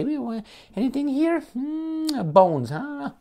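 high, thin scavenger voice, sniffing around; squeaky high vulture eyeing the leftovers. Acted. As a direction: play a greedy vulture picking at bones. Vultures voiced in animal analogy.

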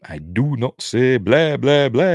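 mock Transylvanian count, blahs rising loud; Hotel Transylvania Dracula. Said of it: "Hotel Transylvania".

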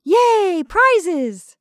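very high, loud whoop; excited cheer. A narrator's reading. Party guests hear of prizes, excited cheer.

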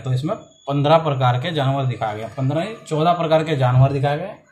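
usual speaking voice

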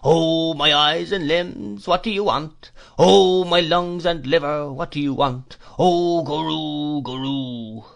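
put-on high, drawn-out whine of a mad old man; crazed old man's high singsong whine; fierce monotonous whine. A narrator's reading. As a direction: wail each exclamation slowly, letting it fall away. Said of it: "in a fierce monotonous whine".

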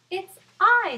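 high, loud squeaky fly; a tiny fly answering proudly. Acted. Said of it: "said the fly".

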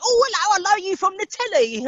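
put-on very high, loud gushing old lady squeal; hammed-up old nan cooing at a TV face; Catherine Tate voice. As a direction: put on a shrill-pitched, delighted granny greeting. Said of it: "Catherine Tate's sitting there".

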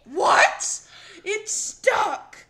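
loud, high-pitched shout; outraged disbelief. Real, not acted. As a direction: yell it in shocked protest. Outraged disbelief at stuck target.

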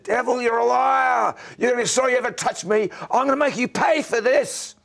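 high, loud re-enacted scream of defiance; angry scream. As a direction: scream it at the devil, full force. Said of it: "I stood up and I screamed".

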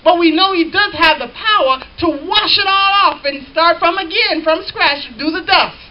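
loud, driving shout on "does"; preaching shout. Real, not acted. Preacher shouting to the congregation about God's power. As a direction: proclaim God's power at full voice.

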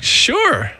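high, falling, eager reply; eager agreement. Retold or imitated. Eager answer to writing invitation.

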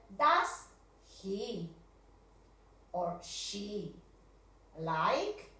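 slow, spaced question read; question read slowly. Shown as a demonstration. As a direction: lay out each word of the question. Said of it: "una question que vamos a hacer".

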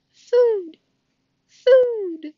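put-on high, loud baby bird cries; tiny high-pitched chick voice; peeping gosling. A narrator's reading. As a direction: squeak it like a hungry hatchling. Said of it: "peeped the gosling".

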